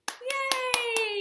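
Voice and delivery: put-on high, bright cheer for the kids; cheering yay. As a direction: celebrate like the kids just won. Cheers at end of rhyme.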